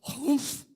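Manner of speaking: sulky huff. Retold or imitated. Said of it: "he comes out and he goes".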